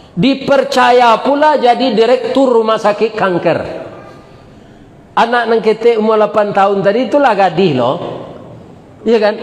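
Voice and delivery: high rousing sermon delivery; preaching uplift. Acted. Preacher to listeners, rising in rousing sermon delivery. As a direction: lift the congregation with it.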